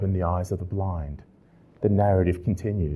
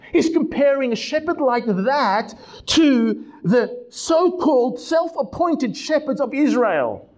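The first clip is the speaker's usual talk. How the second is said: raised, high preaching voice, building conviction; 12 semitones higher than usual. Acted. Pastor preaching to his congregation, raised and fervent.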